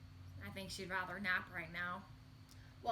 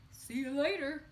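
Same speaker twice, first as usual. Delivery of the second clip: a hint of a smug gator, louder; lightly voiced gator; 4 semitones higher, 8 dB louder than usual. Gator bidding the tiny frog goodbye, mild smug voice. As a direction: toss it off like a sly gator. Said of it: "says the gator".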